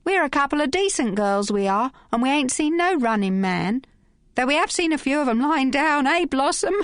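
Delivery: put-on high cheeky Cockney girl; brassy Cockney, pitched well up; Cockney tart teasing the thugs; Cockney accent. A narrator's reading. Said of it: "excursus into Cockney".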